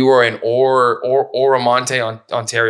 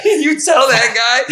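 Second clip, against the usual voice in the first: egging on; 10 semitones higher, 3 dB louder, much slower than usual. Retold or imitated. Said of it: "I remember being like".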